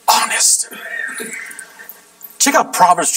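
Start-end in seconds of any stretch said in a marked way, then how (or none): 0.1-0.7 whisper. Shown as a demonstration. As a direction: drop to a whisper for the word. Whispered reveal of point.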